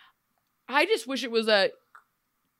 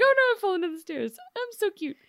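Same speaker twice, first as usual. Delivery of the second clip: high, fast, cutesy mock voice; mocking cutesy damsel; ditzy clumsy girl; 11 semitones higher, faster than usual. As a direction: play the adorable klutz.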